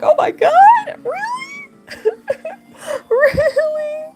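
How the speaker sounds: very high, squeaky near-cry imitation; mock tearful disbelief. New driver told her license photo is next, mock tearful disbelief. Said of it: "she saw me starting to cry".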